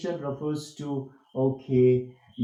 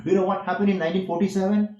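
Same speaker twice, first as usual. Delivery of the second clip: quick, raised-pitch question on "happened in nineteen forty-seven"; question intonation; 5 semitones higher, 4 dB louder, much faster than usual. Shown as a demonstration. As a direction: fire off "Do you know what happened" as a question. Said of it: "That should be a question".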